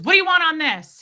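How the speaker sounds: mock the server's pushy, automatic question; bot-like server. Server ignoring the customer, loud bot-like demand. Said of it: "He just was like a bot".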